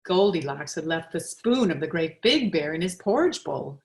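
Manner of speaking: usual speaking voice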